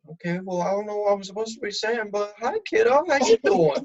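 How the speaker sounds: put-on chirpy forced greeting to a kid, rising in pitch; mock awkward kid talk. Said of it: "I'm like".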